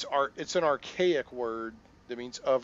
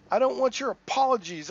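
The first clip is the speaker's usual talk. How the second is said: loud, high, bitter outburst; angry rejection; 13 semitones higher, 12 dB louder than usual. Acted. Pico refuses the stranger's apology, loud and bitter. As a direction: push the apology away hard.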